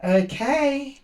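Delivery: usual speaking voice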